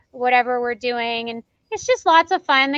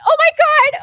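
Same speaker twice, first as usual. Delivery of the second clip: high, loud gasp of delight; starstruck squeal; 13 semitones higher, 6 dB louder than usual. Retold or imitated. Fan meeting her idol, high excited squeal. Starstruck on seeing Flynn Rider.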